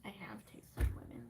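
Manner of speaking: hushed whisper; dry whispered aside. Acted. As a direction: breathe it secretly. Whispered quip.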